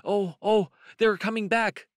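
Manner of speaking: high, loud alarmed cry; frightened. A narrator's reading. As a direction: panicky storybook rabbit raising the alarm. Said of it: "But Benjamin was frightened".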